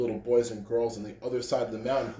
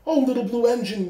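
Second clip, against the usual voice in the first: high, begging cry; pleading toys; 9 semitones higher, 4 dB louder than usual. A narrator's reading. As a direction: plead with her. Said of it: "cried the dolls and the toys".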